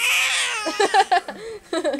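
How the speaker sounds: high-pitched burst of giggles; real giggling fit; high laugh. Real, not acted. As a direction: crack up, pitch shooting up. Laughs after saying sorry.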